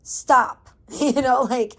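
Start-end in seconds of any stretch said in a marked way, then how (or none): 0.0-0.7 loud, abrupt command to an inner voice; firm self-talk. Retold or imitated. Woman ordering the panic voice in her head to stop, firm and loud. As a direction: cut the thought off loudly and firmly. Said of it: "I've had to, like, be like".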